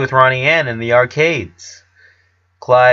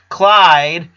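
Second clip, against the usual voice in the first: loud raised call of a name; sharp call; 7 semitones higher, 6 dB louder than usual. A narrator's reading. Lincoln losing patience with Clyde, calling sharply. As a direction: snap him out of it. Lincoln snapping friend to attention.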